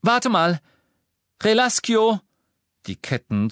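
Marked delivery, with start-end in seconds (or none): 0.0-2.2 high loud incantation; shouted spell. Acted. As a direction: cry out the release charm. Hermione casts spell.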